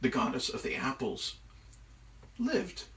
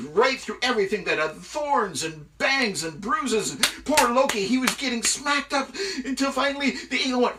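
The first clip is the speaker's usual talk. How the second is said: high, loud, breathless-paced storytelling; excited story narration; 11 semitones higher, 7 dB louder than usual. A narrator's reading. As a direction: tell the ordeal with rising alarm.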